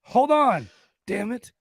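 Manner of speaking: high, loud outburst; exasperated shout. Real, not acted. Host halting his own laughing fit, exasperated shout. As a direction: call a halt through the giggles.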